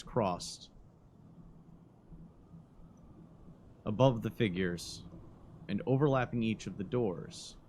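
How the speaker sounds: slow, hushed scene-setting narration. Acted. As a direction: paint the scene slowly and quietly. GM describes the ruined doorway.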